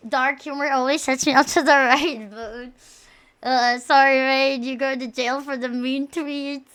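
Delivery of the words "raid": usual speaking voice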